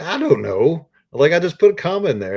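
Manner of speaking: squeaky, high, sheepish shrug of a voice; mock clueless writer. Answering the editor's comma question, mock clueless and high-pitched. Self quoted, clueless about editing.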